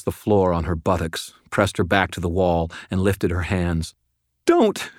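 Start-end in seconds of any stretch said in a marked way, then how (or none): 4.4-4.9 very high squeaked cry; high frightened shriek; panicked plea. A narrator's reading. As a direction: yelp it like a cornered, terrified woman. Cornered woman pleads with wizard.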